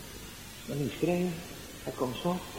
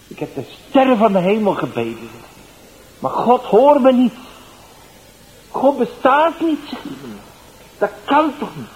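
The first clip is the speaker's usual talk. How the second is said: much higher and louder, rising to a despairing cry; anguished shout, slowing on God bestaat niet; desperate outcry; 9 semitones higher, 11 dB louder than usual. Retold or imitated. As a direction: build from complaint to a loud, high outburst against God. Dying man's despair at God.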